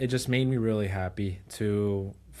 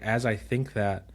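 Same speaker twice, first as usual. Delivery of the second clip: ordinary delivery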